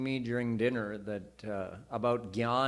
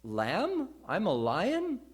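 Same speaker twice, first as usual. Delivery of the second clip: amazed realisation; 6 semitones higher, 3 dB louder, slower than usual. Retold or imitated. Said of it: "Then he said".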